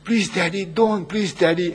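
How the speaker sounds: high-pitched imitation of a begging child; acted tearful plea, without real sobs; crying child pleading. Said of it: "the child cries and said".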